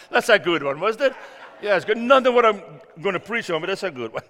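laughing. Real, not acted. Laughing after his joke.

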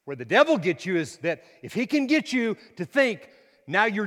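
loud, high fervent preaching; fiery pulpit preaching; preaching at full force. Acted. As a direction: drive it home to the congregation.

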